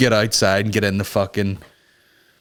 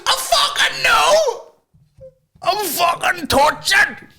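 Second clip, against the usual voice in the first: put-on kid's laughing screech; much slower than usual. Kid mocking the woman, a high laughing screech. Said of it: "And he was".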